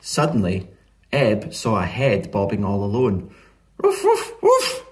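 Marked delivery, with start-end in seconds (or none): 3.8-4.8 rapid, high-pitched yaps; squeaky triple ruff; loud dog barking. A narrator's reading. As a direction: bark urgently like a small dog. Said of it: "barked as loud as she could".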